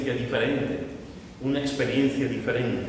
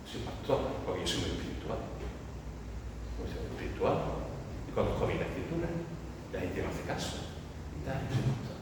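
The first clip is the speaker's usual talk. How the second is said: hushed, pious self-importance; boastful pastor; 4 semitones lower, 11 dB quieter, faster than usual. Acted. Imagined pastor boasting of being spiritual, smugly pious. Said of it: "le encanta presumir de lo que no deben de presumir".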